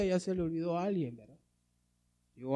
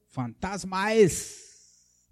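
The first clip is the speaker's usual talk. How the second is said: drawn-out, higher mock cry of alarm; voices of fear; 5 semitones higher, 4 dB louder, much slower than usual. A narrator's reading. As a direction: act out the frightened disciples, stretching it. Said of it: "dieron voces de miedo".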